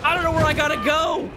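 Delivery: high panicked wail. Real, not acted. Gamer lost while fleeing the monster, panicked.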